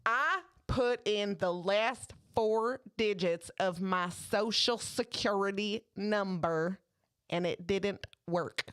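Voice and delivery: slow, deliberate Southern customer imitation; earnest, slow Southern lady; Southern accent. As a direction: draw out each word in mock Southern. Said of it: "I did the accent".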